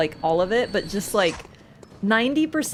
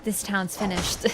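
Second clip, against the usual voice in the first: lightly voiced Walter; 3 dB quieter than usual. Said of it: "when Walter starts backseating you".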